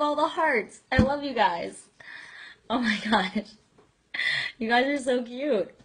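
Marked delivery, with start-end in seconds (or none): none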